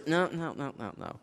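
soft trailing no, no, no; mock dismissive no. Real, not acted. Preacher waving off the men's excuse, softly. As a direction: shake it off quietly. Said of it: "rejecting the excuse".

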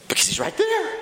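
very high, squeaky exasperation; exasperated insistence. Acted. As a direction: squeal the obvious answer at a stubborn friend. Exasperated end of acted argument.

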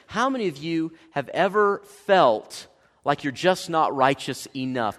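ordinary delivery

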